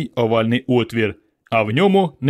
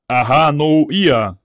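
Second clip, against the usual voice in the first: alien speech; 3 semitones higher, 4 dB louder, slower than usual. Acted. Alien speaks strange words.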